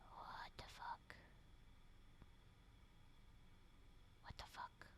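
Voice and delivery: disbelieving whisper at the screen; stunned whisper. Real, not acted. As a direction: breathe it out in disbelief. Shocked reaction to story twist.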